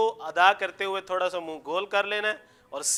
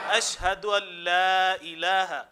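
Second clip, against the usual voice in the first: very slow, drawn-out Arabic supplication; measured teaching chant, phrase by phrase; repeat-after-me recitation; much slower than usual. Shown as a demonstration. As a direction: stretch each phrase for students to repeat. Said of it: "کفارہ مجلس کی دعا پڑھتے ہیں".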